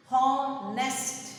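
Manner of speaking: "Honest" in two loud, separate beats; syllable by syllable. Shown as a demonstration. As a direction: sound out both syllables of "Honest" firmly. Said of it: "Two syllables".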